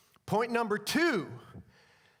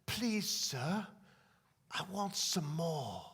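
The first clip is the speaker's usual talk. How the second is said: soft, slow orphan's plea; small, hesitant request to an imposing master; timid orphan; 2 semitones lower, 5 dB quieter, slower than usual. Acted. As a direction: ask meekly, drawing out the last words. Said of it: "he stirs up the courage".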